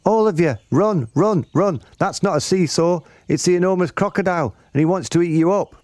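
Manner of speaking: high, urgent shouted warning; Mugglewump. A narrator's reading. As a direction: squeal the alarm like a frantic monkey. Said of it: "Mugglewump shouted to the children".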